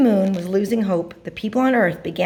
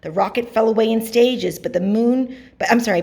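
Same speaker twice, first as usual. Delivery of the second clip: plain reading voice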